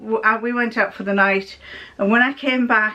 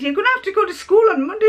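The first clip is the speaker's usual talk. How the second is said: quick, raised mum voice telling her off; firm mum; 10 semitones higher, much faster than usual. Retold or imitated. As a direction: hurry the words, a touch of pleading. Mum insists daughter goes to school.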